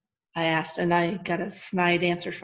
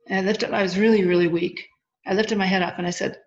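normal talking voice

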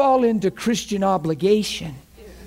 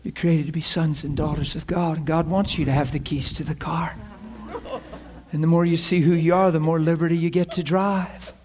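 low, softer, measured comforting; gentle reassurance; 7 semitones lower, 5 dB quieter than usual. Retold or imitated. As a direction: calmly reassure a man who is crying. Reassuring the crying man.

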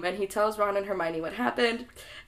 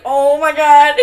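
very loud, higher mock horror; horrified yell; 5 semitones higher, 15 dB louder, slower than usual. Neville seeing Harry scream, horrified yelling. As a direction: bellow it in theatrical panic.

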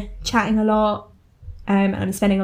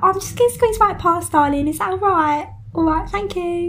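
high, singsong customer voice; put-on squeeze-past politeness, very high; imitated customer; 10 semitones higher than usual. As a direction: mimic an over-polite Essex shopper, pitched right up. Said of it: "I get that so much at work".

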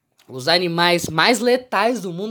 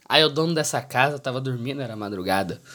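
normal talking voice

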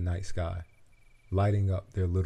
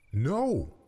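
sharp, higher snap; snapped; 7 semitones higher than usual. A narrator's reading. Butterfly snapping back at the ladybug, sharp and higher. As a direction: bite it back fast. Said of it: "the butterfly snapped back".

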